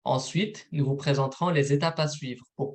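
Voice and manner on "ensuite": usual speaking voice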